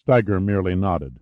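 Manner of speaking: ordinary delivery, nothing marked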